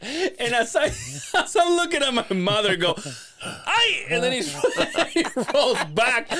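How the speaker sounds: high-pitched, breaking into laughter; laughing while telling. Real, not acted. Son telling friend about mom's tumble, laughing. Tells mother's fall while cracking up.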